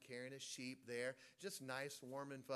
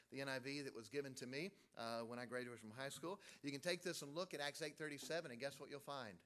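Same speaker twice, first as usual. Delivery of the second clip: normal talking voice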